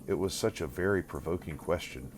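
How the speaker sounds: plain reading voice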